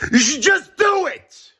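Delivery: very high-pitched, drawn-out yell; exasperated shout. Real, not acted. Ranting at the billionaire to donate, exasperated shout.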